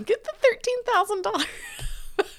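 high, laughing through the regret. Real, not acted. Wife laughing at her own boat choice, high and giggly.

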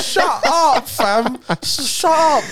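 high-pitched indignation breaking into laughter; exasperated rant. Real, not acted. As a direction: protest like an outraged friend in banter. Ranting at friends telling him off.

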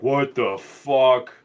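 slow, drawn-out mock disbelief; retold drunken shock. Acting out the drinker after shot number five, drawn-out shock. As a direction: stretch each word as the hit lands. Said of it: "you'll take number five and be like".

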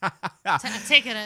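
normal talking voice

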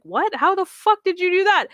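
shocked viewer blurting out at a plot twist; stunned disbelief. Retold or imitated. Fan reacting to the show, high amazed outburst.

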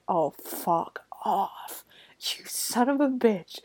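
whispered angry curse; furious whispered swearing at the screen; hissed curse. Retold or imitated. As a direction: spit it out under your breath. Viewer cursing the wicked thing.

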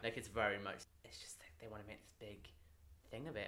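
whispered aside. Real, not acted. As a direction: drop into a whisper.

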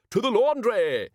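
put-on loud, high, commanding old wizard; booming wizard. A narrator's reading. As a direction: proclaim it like a pompous leader. Wizard chief commands loudly.

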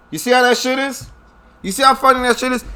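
high, mocking disbelief; incredulous. Real, not acted. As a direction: sneer at the hypocrisy. Mocking rhetorical question, pitched up.

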